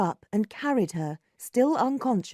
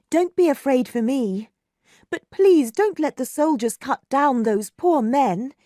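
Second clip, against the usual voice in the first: louder, pleading with passion; passionate; 5 semitones higher, 6 dB louder than usual. A narrator's reading. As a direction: beg him with feeling. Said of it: "said Margaret passionately".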